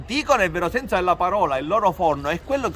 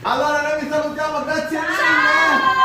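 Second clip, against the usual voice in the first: cheerful high thanks; 8 semitones higher than usual. Real, not acted. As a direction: draw it out cheerfully. Cheerful farewell.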